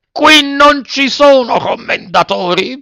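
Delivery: very high, slow exasperated rebuff; stretched, high-pitched stern reply; harsh retort. A narrator's reading. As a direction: refuse him, dragging out each word. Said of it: "replicò duramente".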